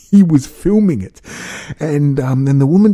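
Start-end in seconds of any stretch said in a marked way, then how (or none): 0.1-1.2 real disbelieving laughter, higher and louder; laughing in disbelief. Real, not acted. Telling viewers about the filming husband, laughing. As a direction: laugh through it, pitched up.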